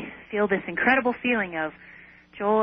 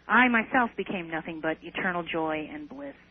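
usual speaking voice

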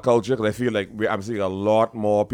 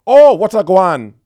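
loud, high, drawn-out Jamaican patois imitation; overdone Jamaican accent, slow and booming; mock Jamaican greeting; 9 semitones higher, 14 dB louder, slower than usual. Mimicking Toronto people's mistaken Jamaican greeting.

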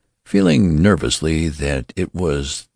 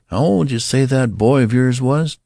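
a lightly higher, prying shop clerk; the clerk probing, raised a bit; curious clerk; 8 semitones higher, 3 dB louder than usual. A narrator's reading. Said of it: "demanded the clerk curiously".

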